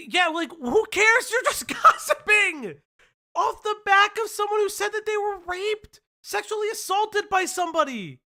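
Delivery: high, loud exasperated disbelief; outraged. Real, not acted. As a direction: let the outrage ring high. Outraged at gossip story.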